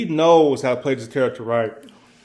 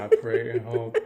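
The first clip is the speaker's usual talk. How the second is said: laughing plea; 3 dB quieter than usual. Real, not acted. Laughing plea for good movies.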